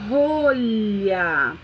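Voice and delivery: put-on rolha said clearly for the child; modelled word. Shown as a demonstration. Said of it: "por exemplo".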